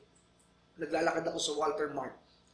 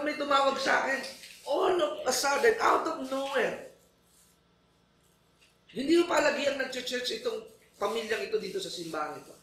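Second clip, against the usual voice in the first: usual speaking voice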